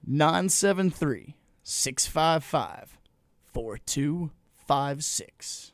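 slow, carefully spaced digits; over enunciating. Shown as a demonstration. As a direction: read each number out slowly. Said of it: "Say it one more time, slowly".

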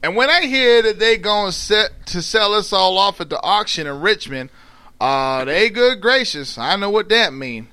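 Southern dialect read-aloud; storytelling in dialect. A narrator's reading. Attempting the slave woman's story, in Southern dialect. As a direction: read it with a put-on Southern accent.

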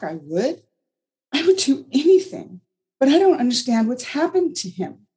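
high, pleading, upset voice; Anna struggling to believe, high and distressed; upset young mother. A narrator's reading. Anna pleading with Margaret, upset and high.

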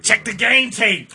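slow, high preachy coach; mock coach voice. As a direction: drag it out like a know-it-all coach. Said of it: "He's like".

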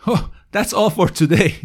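correcting a slip with a laugh; embarrassed laughing fix; laughing correction. Real, not acted. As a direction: laugh it off while fixing the phrase.

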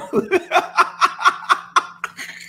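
high, wheezy run of laughter; long laugh. Real, not acted. Host laughing after telling the guest to go ahead, long high laugh. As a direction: keep laughing, high and helpless.

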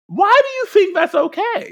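high, exasperated squeal of disbelief; exasperated mock outrage. Teacher back from a sub, scolding the wild class in outrage. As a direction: shriek it like a teacher who can't believe the mess.

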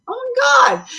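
high, loud burst of amazement; amazed exclamation. Real, not acted. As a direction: blurt it out, truly impressed. Amazed reading guest's credits.

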